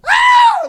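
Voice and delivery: high, loud shriek of a startled wife; blood-curdling scream. Retold or imitated. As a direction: scream like she found a body.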